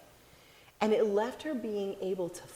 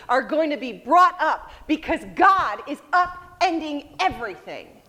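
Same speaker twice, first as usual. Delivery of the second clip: loud, higher, exultant proclaiming; raucous celebration; 5 semitones higher, 8 dB louder than usual. Acted. Mary celebrates with Elizabeth, loud exultant proclaiming. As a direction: shout the news that everything is upended. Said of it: "It's a raucous celebration".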